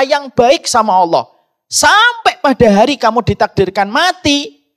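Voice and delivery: high, loud, driving sermon delivery; impassioned Friday sermon voice; fervent preaching. Acted. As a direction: preach it out to the whole hall. Preaching at full force.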